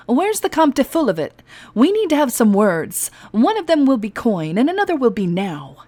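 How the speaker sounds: loud, high, brash demand. Acted. A captain demanding her money from the guard. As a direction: lay down the terms loudly.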